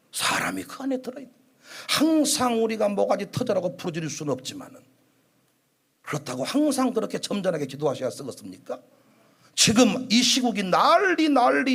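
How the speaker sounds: usual speaking voice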